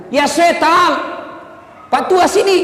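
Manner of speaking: high loud shout to chase him off. Retold or imitated. Mosque caretaker chasing the stranger, loud high shout. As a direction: bark at the old man to get out.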